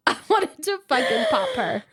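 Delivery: crying with laughter; real helpless laughter; laughing hard. Real, not acted. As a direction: laugh through the words. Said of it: "laughing while saying".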